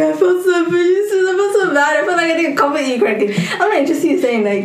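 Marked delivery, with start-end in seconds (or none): 0.0-3.3 high, exaggerated mimicry of an anxious apology, laughing through it; teasing high-pitched imitation with a laugh; mock fretful partner. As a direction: play the boyfriend as a hand-wringing worrier.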